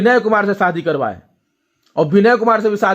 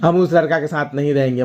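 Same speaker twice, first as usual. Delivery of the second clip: imitated crying plea. Sister phoning her brother to leave the husband, imitated crying. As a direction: mimic a sister whimpering down the phone. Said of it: "रोते हुए हमको phone".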